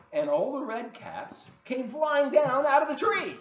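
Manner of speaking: rising excitement; excited climax. A narrator's reading. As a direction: let it burst out as the caps fly. Climax of caps falling.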